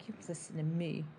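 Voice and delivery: ordinary talk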